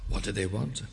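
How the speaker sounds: normal talking voice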